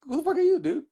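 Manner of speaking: high, scoffing sneer at a nobody; mocking high sneer. Bouncer crowd mocking the unknown DJ at the door, in a high scoffing voice. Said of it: "they pretty much laughed at me".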